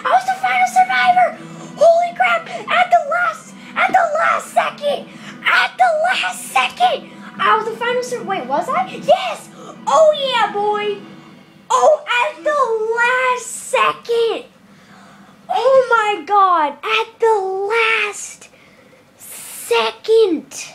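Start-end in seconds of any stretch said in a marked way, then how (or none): 0.1-7.1 much higher, louder triumphant yelling; victory shout. Real, not acted. Wins as final survivor. 8.9-20.7 high, loud drawn-out celebration; elated cheer. Real, not acted. Still celebrating the win.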